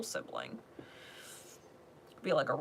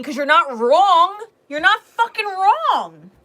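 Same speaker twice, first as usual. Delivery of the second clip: high, loud, drawn-out insistence; emphatic shouting; 10 semitones higher, 14 dB louder, slower than usual. Real, not acted. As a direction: shout the reassurance, stretching it out. Emphatic reassurance building to shouting.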